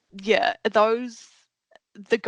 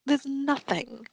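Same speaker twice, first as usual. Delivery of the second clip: exasperated retort; 6 semitones higher, slower than usual. Retold or imitated. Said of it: "And I'm like".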